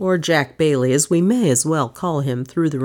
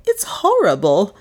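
higher, horrified exclamation; shocked, raised voice; gasped horror; 5 semitones higher, 3 dB louder than usual. A narrator's reading. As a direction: gasp it, appalled. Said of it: "gasped Miss Cornelia".

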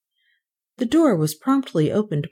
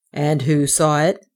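lower-pitched official voice; questioning policeman; 4 semitones lower than usual. A narrator's reading. Policeman has heard Kay's words, questioning. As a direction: inquire in a low, level voice.

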